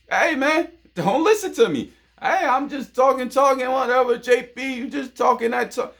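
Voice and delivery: high squeaky mock of a doubter brushing him off. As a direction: play the skeptic who waves off his advice. Mocking a critic of his advice.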